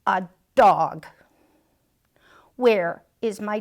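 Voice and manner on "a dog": loud, fast, indignant wail; indignant protest. Acted. Dressed-up dog protesting his costume, indignant.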